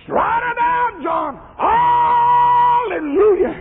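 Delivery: high preacher's call, falling on the name; high-pitched revival exclamation; exultant cry. Acted. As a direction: cry out the command from the pulpit. Preacher crying out mid-sermon.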